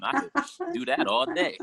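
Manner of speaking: high, slow mock boast; hammed-up cocky boast; cocky bravado. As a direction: brag up high, stretched out. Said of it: "I was like".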